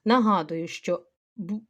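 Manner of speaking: normal talking voice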